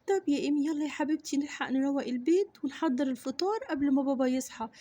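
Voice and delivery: ordinary talk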